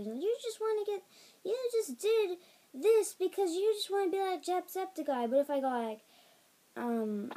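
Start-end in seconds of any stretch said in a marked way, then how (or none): 0.0-5.3 much higher mock-critic voice; imagined critics. Viewers accusing vlogger of copying, high mock complaint. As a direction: accuse in a high mocking pitch. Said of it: "think people are gonna like that".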